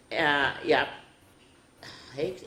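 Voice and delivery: usual speaking voice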